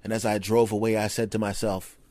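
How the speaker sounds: usual speaking voice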